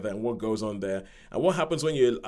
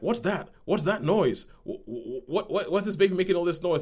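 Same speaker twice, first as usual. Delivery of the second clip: baffled; 7 semitones higher than usual. Retold or imitated. Couple baffled by crying baby.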